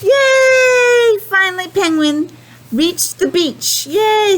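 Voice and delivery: high cheering shout of relief; delighted shout; cheering yay. Acted. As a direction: cheer as if finally arriving. Penguin reaches the beach.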